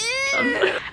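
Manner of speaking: a sudden high burst of laughter. Real, not acted. Laughing at her own story, a high burst of laughter. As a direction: crack up at yourself.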